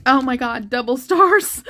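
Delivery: joy spilling over into tears; overjoyed. Real, not acted. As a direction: burst out in delighted disbelief, half laughing, half crying. Joyful tearful reaction to card.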